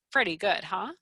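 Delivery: ordinary delivery, nothing marked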